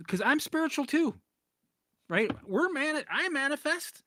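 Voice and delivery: mock guru. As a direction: send up a starry-eyed manifesting type. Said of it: "mocking spiritual gurus".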